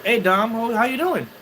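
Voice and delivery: excited greeting. Real, not acted. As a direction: call out happily to him. Calls out to a new chat viewer.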